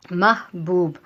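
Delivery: teacher spells out محبوب syllable by syllable, loud; clear demo word. Shown as a demonstration. Teacher showing students how محبوب should sound. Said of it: "تو پہلا لفظ دیکھیے".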